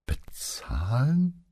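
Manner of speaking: hushed, drawn-out BEZAHLEN climbing high; stunned disbelief. A narrator's reading. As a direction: stretch "Bezahlen" slowly upward, half whispered and incredulous. Paola repeats it in disbelief. (fassungslos, gedehnt und hauchig nachgefragt)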